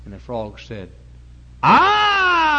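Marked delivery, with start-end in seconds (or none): 1.6-2.5 sudden high yelled boast; proud shout. Acted. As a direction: blurt it out, high and loud. Frog shouts proudly in story.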